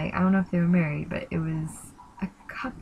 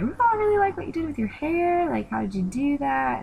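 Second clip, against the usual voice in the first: much higher, sugary Liverpool chatter; gushing compliment; 10 semitones higher than usual. Retold or imitated. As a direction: flatter her hair like an excited new friend. Liz flattering the girls.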